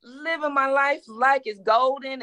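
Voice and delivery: ordinary talk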